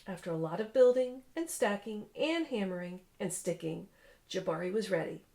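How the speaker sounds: lively read-aloud storytelling voice; story narration. A narrator's reading. Parent reading a picture book to a child, animated narration.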